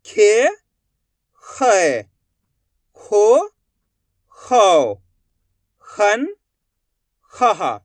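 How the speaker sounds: alternating rising and falling syllables; drawn-out drill with a pause after each syllable; repeat-after-me recitation. Shown as a demonstration. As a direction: recite the barakhadi for pupils to echo. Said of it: "मैं किस तरीके से इनका उच्चारण कर रहा हूँ".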